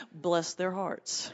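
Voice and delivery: slow, soft, laughing "bless their hearts"; syrupy mock-sympathy; sweet Southern jab. Comedian teasing Southern Baptists, slow and softly laughing.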